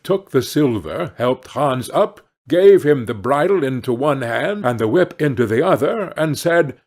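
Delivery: plain reading voice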